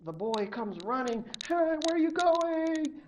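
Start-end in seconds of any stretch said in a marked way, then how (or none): 1.5-3.0 high, loud call after her. Acted. Boy running after the rising girl, high alarmed call. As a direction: shout up to her as she drifts away.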